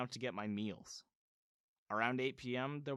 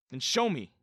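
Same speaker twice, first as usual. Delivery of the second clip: much louder demand; 3 semitones higher, 11 dB louder than usual. A narrator's reading. Son challenging his stepmom for proof, loud demand. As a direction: insist on it hard. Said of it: "I demanded".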